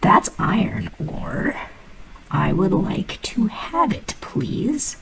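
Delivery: slow, breathy whisper begging for the iron ore; pleading whisper. Acted. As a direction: coax it out in a hushed whisper.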